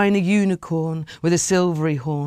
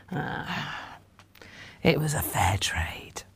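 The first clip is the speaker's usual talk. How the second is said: wry whisper; 2 semitones lower, 10 dB quieter, slower than usual. A narrator's reading. Narrator's wry aside.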